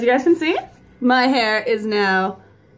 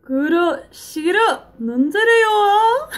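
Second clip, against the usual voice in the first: high anime character voice; high, slow anime voice with laughter; Juzo voice; 11 semitones higher, 3 dB louder, much slower than usual. Retold or imitated. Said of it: "I have Juzo's".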